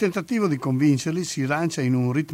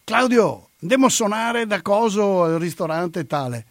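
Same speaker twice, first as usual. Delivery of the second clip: lightly acted, raised-pitch call to a mate; drummer friend calling; 6 semitones higher, 4 dB louder than usual. Drummer turns up to invite Claudio out to play, lightly acted eager call. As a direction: call out the name high and loud, then ease off. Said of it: "il suo amico batterista e gli dice".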